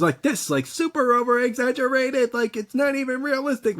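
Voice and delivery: high over-the-top anime voice; anime character voice. Retold or imitated. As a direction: squeal it like a cartoon anime hero. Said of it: "they would talk like anime characters".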